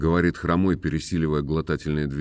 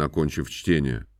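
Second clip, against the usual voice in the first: ordinary delivery, nothing marked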